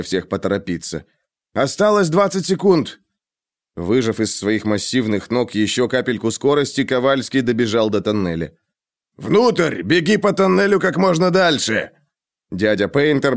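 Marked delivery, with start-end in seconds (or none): 1.5-3.0 urgent call. A narrator's reading. Countdown warning. 9.2-11.9 urgent command. A narrator's reading. Ordering into tunnel.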